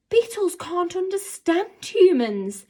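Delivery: stunned by what she sees; astonished. A narrator's reading. Girl watching the beetle obey, astonished. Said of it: "she said, astonished".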